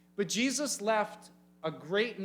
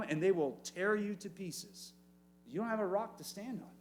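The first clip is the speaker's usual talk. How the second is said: normal talking voice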